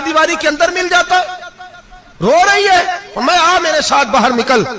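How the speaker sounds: in a fury. Retold or imitated. Husband rebuking his weeping wife for ingratitude, furious high voice. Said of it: "حضرت عمر جلال میں آ گئے".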